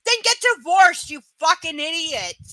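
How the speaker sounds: loud scolding; angry retort. Retold or imitated. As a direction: tell them off with loud, fed-up force. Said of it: "And I'm like".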